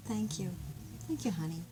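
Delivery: soft, gentle thank-yous to a partner; tender thanks. Shown as a demonstration. Thanking a partner for little things, soft and sincere. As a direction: thank them softly and sincerely. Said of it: "you say".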